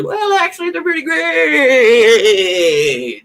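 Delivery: very high, squeaky mock voice; whiny smug know-it-all. Mocking fans changing their tune.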